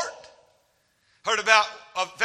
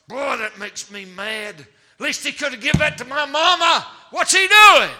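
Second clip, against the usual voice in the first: put-on boy getting mad; 2 semitones higher, 6 dB louder than usual. Hungry boy resenting the preacher eating, from muttering to loud. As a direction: let the bitterness boil over into a cry. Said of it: "I can see bitterness rise up".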